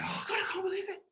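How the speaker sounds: high, soft, squeezed exclamation; hushed excitement. Retold or imitated. Dad amazed after being told off, hushed high excitement. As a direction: squeal it under your breath.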